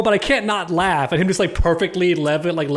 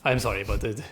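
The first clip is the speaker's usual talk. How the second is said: a lower put-on Raiden accent that keeps drifting; lightly voiced weary god with a slipping accent; wandering put-on accent; 5 semitones lower, 4 dB quieter than usual. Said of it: "your accent's all over the place".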